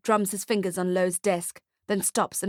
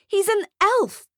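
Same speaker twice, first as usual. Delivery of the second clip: incredulous; 12 semitones higher, 5 dB louder than usual. Acted. Nick protests Bushy becoming Santa.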